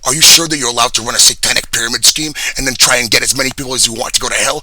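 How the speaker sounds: full-volume enraged rant; furious shout. Real, not acted. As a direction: scream the accusation at them. Ranting at an imagined accuser.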